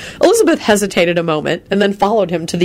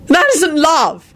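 slow, fierce accusation; 11 semitones higher, slower than usual. A narrator's reading. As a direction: bite off each word fiercely. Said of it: "he finished fiercely".

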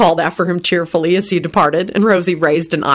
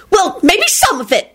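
screeched; 13 semitones higher than usual. A narrator's reading. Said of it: "she screeched".

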